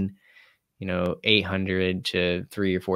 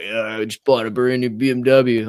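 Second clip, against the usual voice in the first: mock bragging buddy; 3 semitones higher, 5 dB louder than usual. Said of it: "They're like".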